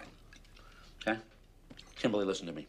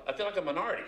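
usual speaking voice

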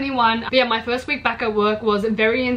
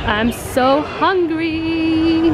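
whiny drawn-out; 5 semitones higher, 3 dB louder, much slower than usual. Real, not acted. Hungry, dragged out.

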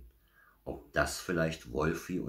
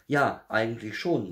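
lightly sheepish little bear, higher and louder; embarrassed; 7 semitones higher, 6 dB louder than usual. A narrator's reading. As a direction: admit it a bit sheepishly. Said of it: "murmelte Berti verlegen". (leicht verlegen, mit hoher Stimme)

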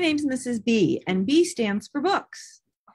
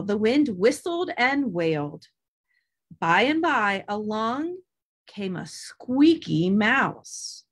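plain reading voice

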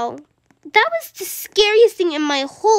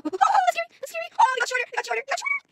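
squeaky fear; 11 semitones higher, 5 dB quieter, much faster than usual. Real, not acted. Scary path shrinking.

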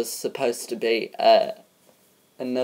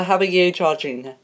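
ordinary talk, nothing marked

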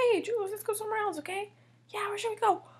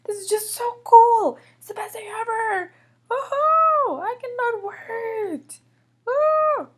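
loud gushing broken by high whoops; gush, then whoop with impatience; giddy excitement; 4 semitones higher, 8 dB louder than usual. Acted. Julian cannot wait to go to the park, giddy excitement.

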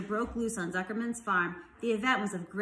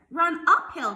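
high, loud sheep's shout; crying out; 7 semitones higher, 9 dB louder, slower than usual. A narrator's reading. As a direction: cry out urgent advice. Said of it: "cried the sheep".

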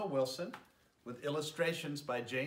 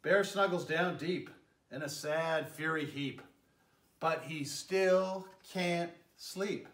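gentle, lilting read-aloud voice; storybook narration; 2 semitones higher, 4 dB louder, slower than usual. A narrator's reading. Mayor reading a bedtime story to children, lilting narration. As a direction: tell it like a bedtime story for little ones. Reads picture book to children.

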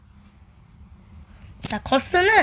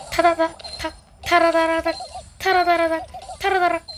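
quick rat-a-tat mouth noise; imitated rattling sound effect; 3 semitones higher than usual. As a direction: rattle it off like gunfire.

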